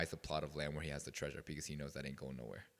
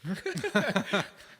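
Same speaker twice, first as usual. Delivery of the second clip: high, loud burst of laughter; 10 dB louder than usual. Real, not acted. Pastor laughs at his own racy-question joke, a loud high burst of laughter.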